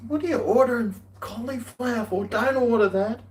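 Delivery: high-pitched incredulous objection; mock protest. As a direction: retell it as an exaggerated, high complaint. Retells objecting to her order.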